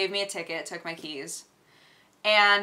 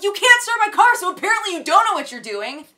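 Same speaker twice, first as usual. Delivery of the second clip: high, quick, loud comeback; rapid, high-pitched exasperated retort; indignant retort; 9 semitones higher, 7 dB louder, faster than usual. Retold or imitated. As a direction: fire back at a know-it-all. Acted comeback to the valet.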